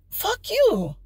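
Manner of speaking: angry curse. Retold or imitated. Cursing at the honking driver.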